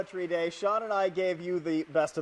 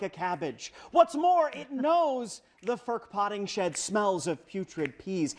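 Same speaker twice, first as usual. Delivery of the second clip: mock poetry recital; 4 semitones higher than usual. Host reciting her own Vogon poem on morning TV, mock-grand recital. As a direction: declaim awful poetry with grand pomp. Reads bad poem aloud.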